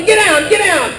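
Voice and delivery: high, quick shouted command; mock yell. Caregiver yelling the dogs down, mock yell. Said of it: "Here I am yelling".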